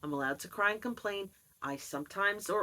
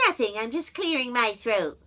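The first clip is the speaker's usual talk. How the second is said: a touch higher, louder, defensive; mother's denial; 5 semitones higher, 6 dB louder than usual. Retold or imitated. Daughter acting her mother's denial, slightly raised. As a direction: brush it off defensively.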